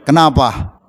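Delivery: ordinary delivery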